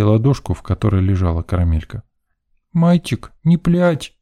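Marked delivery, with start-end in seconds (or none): 2.7-4.1 high-pitched lisping tot; little girl. A narrator's reading. Little girl comforts a crying boy, high and childish. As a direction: coo it like a small child consoling. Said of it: "маленькая девчушка в панамке".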